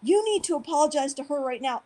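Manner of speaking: retold indignation. Real estate agent confronting the storyteller, mildly acted indignation.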